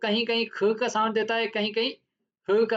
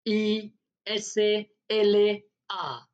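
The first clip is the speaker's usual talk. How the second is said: slow spelling; much slower than usual. Shown as a demonstration. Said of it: "ईस्ला".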